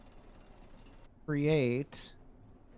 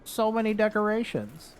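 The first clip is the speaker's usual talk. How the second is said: imagined amazed reaction; 7 semitones higher, 4 dB louder than usual. Acted. Player imitating viewers coming back to see decorations, mock amazement. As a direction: gush in a higher voice, hammed-up awe. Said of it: "you'd be like".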